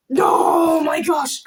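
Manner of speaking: loud, slow near-tearful shriek; joyful cry. Real, not acted. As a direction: cry out overwhelmed with joy. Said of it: "I think I might cry".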